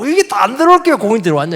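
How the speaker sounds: loud, high, fast complaining whine; aggrieved opponent. Retold or imitated. The tennis opponent grumbling at the lucky shot, loud whine. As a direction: moan it like a sore loser on the tennis court. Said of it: "애통해가지고".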